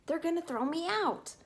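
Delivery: plain reading voice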